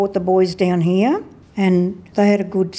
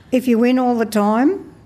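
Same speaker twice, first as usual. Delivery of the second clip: usual speaking voice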